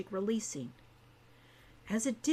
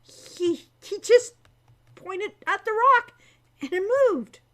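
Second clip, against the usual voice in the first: high, loud, stammered disbelief; stammering shock; 9 semitones higher, 9 dB louder than usual. Acted. Hawk shaken after Al moves the rock, loud high stammer. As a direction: blurt it out rattled and amazed. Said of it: "Hawk stammered".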